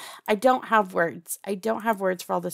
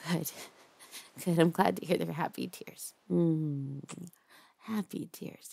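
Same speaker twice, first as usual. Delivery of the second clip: hushed, doting captor who won't let go; 5 semitones higher than usual. Acted. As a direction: lull your captive with creepy tenderness. Holds a soft captor roleplay voice.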